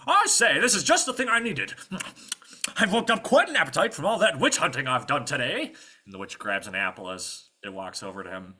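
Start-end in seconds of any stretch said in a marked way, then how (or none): 0.1-5.7 high, loud pompous knight; Sir Green. Acted. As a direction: bluster contentedly over a good meal. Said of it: "Sir Green is saying".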